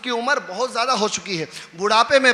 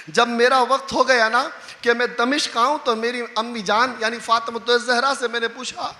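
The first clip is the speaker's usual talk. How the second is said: normal talking voice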